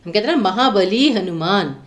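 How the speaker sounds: normal talking voice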